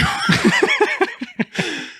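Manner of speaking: high-pitched burst of laughter. Real, not acted. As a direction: cackle at your own joke. Laugh after the punchline.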